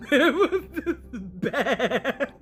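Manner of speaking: slow words broken up by laughter; stretched, giggling verdict; laughing it out. Real, not acted. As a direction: laugh through the line, drawn out slowly. Laughing while judging the backstory.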